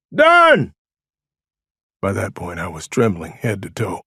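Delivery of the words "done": very high, loud short holler; shouted reply. A narrator's reading. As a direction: shout agreement across a distance. Shouted exchange across the woods.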